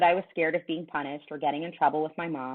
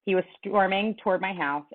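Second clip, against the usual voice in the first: plain reading voice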